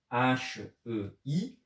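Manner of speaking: H E I spelled letter by letter, slowly; slow letter-by-letter spelling. Shown as a demonstration. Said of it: "Un autre petit exemple".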